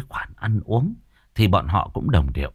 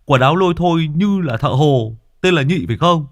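high put-on village woman, questioning; a gossipy woman checks the name; woman villager voice; 8 semitones higher, 7 dB louder than usual. (giọng bà hàng xóm the thé hỏi dò)

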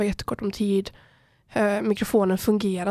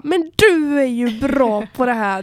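slow, louder mock flattery; gushing recruiter pitch; 3 semitones higher, 6 dB louder, slower than usual. Recruiter mingling with visitors, gushing in slow mock praise. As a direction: talk them up, stretched out and loud.

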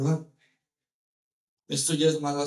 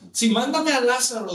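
louder, higher acted demand; bossy order; 5 semitones higher, 5 dB louder than usual. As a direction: insist like someone used to commanding. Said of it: "está acostumbrado a ... A mandar". (exigencia mandona actuada)

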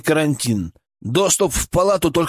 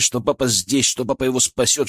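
plain reading voice

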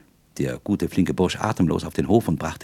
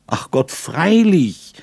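slow, high, louder cry of dismay; exclaiming; 9 semitones higher, 6 dB louder, much slower than usual. A narrator's reading. Young man cries out to her, realising his mistake. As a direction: draw out the exclamation, striking his forehead. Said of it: "rief ich aus und schlug mich".

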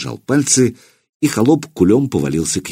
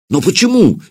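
high, sharp amazed question; sincere surprise; 11 semitones higher than usual. A narrator's reading. Putyata is amazed at Dobrynya's refusal. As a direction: ask it truly astonished. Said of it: "искренне удивился воевода".

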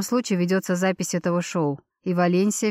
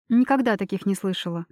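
plain reading voice

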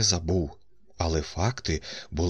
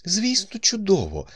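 high-pitched, eager assent; enthusiastic agreement; 13 semitones higher than usual. A narrator's reading. Colonel's wife agreeing with her husband, enthusiastic. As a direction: agree brightly, pitch leaping up. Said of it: "з ентузіазмом погодилась".

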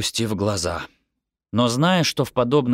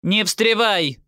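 high, sharp snub; scolding, raised-pitch order; cutting someone off; 12 semitones higher, slower than usual. A narrator's reading. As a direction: shut the child down at once. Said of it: "оборвала меня мама".

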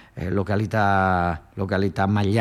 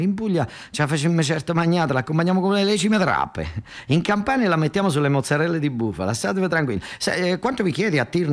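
higher, chatty back-and-forth characters; phone deal voices; 6 semitones higher than usual. Acted. Gianni and Nicola haggling over toxic waste, higher voices. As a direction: play both sides of the call. Acted phone dialogue continues.

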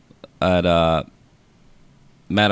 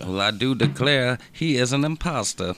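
southern belle; 5 semitones higher than usual. Pretend. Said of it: "That was weird. I've never done that one before".

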